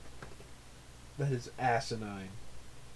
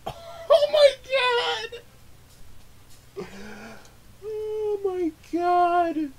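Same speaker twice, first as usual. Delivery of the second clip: shocked screaming; 8 dB louder, slower than usual. Real, not acted. Shocked by gross scene.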